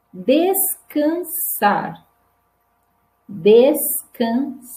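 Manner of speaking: slow syllables, pensar, descansar, cansado, with a hissed s; som de S. Shown as a demonstration. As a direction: hold the unvoiced s in cansado and pause between syllables. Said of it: "Som de S agora". (pensar, descansar, cansado com S longo, sílaba por sílaba)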